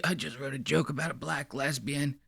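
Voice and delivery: hushed sly mimicry of him; creepy imitation. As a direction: whisper it like a giddy creep. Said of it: "he told it to her like this".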